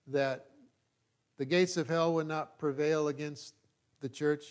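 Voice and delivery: ordinary talk, nothing marked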